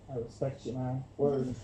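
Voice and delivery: a light Fallon impression, low and quiet; imitating Jimmy Fallon. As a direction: murmur it low like a laid-back talk show host. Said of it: "And Jimmy Fallon would be like".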